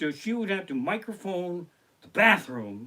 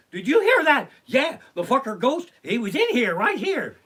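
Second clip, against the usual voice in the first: high, loud, hammed-up alarm; frantic ghost hunters; 9 semitones higher, 9 dB louder than usual. Comic mimics ghost hunters running in after a crash, frantic shouting. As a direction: shout in mock frenzy at a ghost sighting.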